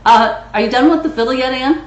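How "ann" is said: ordinary talk, nothing marked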